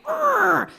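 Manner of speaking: high loud mock growl of bad mood; grumpy growl. In a pissy mood in class, mock growl. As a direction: let out a pissy snarl. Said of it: "I am like".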